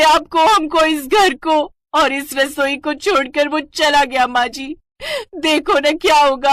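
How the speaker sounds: daughter-in-law mock-mourning the cylinder to her mother-in-law, hammed-up wail. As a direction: wail over the empty gas cylinder like a death. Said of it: "बैठी रो रही होती है".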